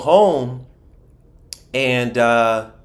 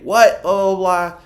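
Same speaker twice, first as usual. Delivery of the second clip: mom going off; 7 semitones higher, 3 dB louder than usual. Retold or imitated.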